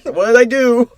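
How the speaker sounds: a hammed-up flustered plea to the guards; pleading his case. Shirley pleading from the jail cell, high mock pleading. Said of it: "he's pleading his case".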